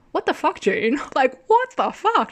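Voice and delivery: high, incredulous exclamation; exasperated outrage. Retold or imitated. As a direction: blurt it in disbelief, then stretch the repeat. Retold outrage at June's teasing.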